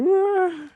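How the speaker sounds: high loud fake yawn; mock yawn. Chat yawning at Terry mid-point, high fake yawn. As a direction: let out a big exaggerated yawn. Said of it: "we're all just like".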